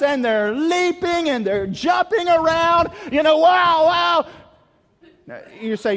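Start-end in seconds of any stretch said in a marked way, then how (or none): 0.0-4.3 high, loud and wild; acting it up. Preacher acting out the leaping prophets, high and loud. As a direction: throw yourself into the frenzy. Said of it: "You're acting up".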